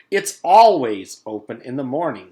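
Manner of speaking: loud, stressed indignant complaint; loud protest. Acted. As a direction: insist it's not your fault. Principal protests.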